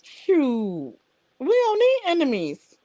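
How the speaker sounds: ordinary talk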